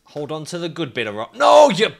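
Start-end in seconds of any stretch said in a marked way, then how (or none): 1.4-1.9 sudden loud, high outburst; frustrated shout. Real, not acted. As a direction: explode as the climber slips. Climber grabs wrong rock.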